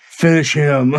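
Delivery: drawn-out game announcer call; mock video-game finishing command; Mortal Kombat announcer. Storyteller on the toilet as a fighting game, mock announcer voice. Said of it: "looking like a Mortal Kombat".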